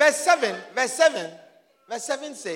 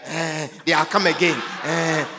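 mock grumbling; 6 semitones lower, 3 dB louder than usual. Mocking grumbling congregants.

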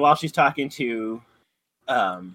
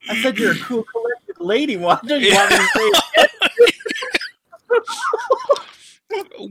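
very high, loud, laughing sing-song; mocking neighbour; 8 dB louder than usual. Geraldine covers her slip to Wanda, high laughing sing-song. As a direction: overdo the innocent cover-up. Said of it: "And she's like".

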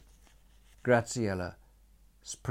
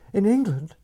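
breathless, much higher and louder; urgent breathless plea; 13 semitones higher, 12 dB louder than usual. A narrator's reading. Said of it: "she said breathlessly".

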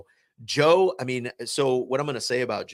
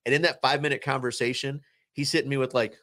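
normal talking voice

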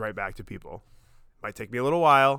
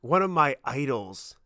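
choked up; slower than usual. Real, not acted. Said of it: "I'm getting choked up now".

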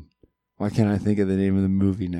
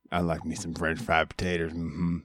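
put-on Carl Childers; 3 semitones lower, 6 dB quieter than usual. Co-host quotes the film to his friend, soft character voice. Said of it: "why I was doing the reference".

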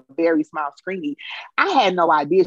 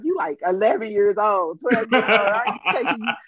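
imitating an incredulous mother, higher pitched; mock motherly telling-off, raised pitch; retold scolding mom; 6 semitones higher than usual. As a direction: scold a child in disbelief, leaning on key words. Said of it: "my mom was like".

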